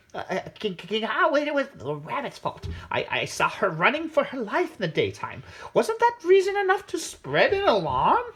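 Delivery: high, squawky crow stammering; flustered crow. A narrator's reading. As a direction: crow stammering out an excuse to the king. Crow excusing itself to king.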